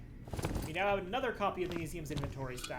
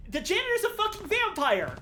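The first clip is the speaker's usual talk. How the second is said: much higher, loud exclamation; excited shock; 13 semitones higher, 10 dB louder than usual. Real, not acted. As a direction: blurt out the revelation. Shocked revelation.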